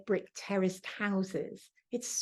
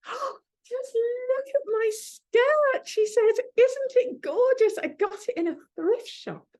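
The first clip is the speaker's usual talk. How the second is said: high, loud, gushing aunt voice; auntie gushing; 4 dB louder than usual. Retold or imitated. Eccentric auntie showing her niece a thrift-shop skirt, high and gushing. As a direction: squeal with delight over the skirt.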